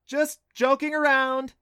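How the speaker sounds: loud, high reassuring shout; girl covering up to her dad; yelling back. A narrator's reading. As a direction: shout it across the camp. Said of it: "Clancy yelled back".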